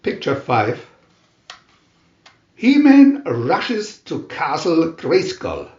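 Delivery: ordinary delivery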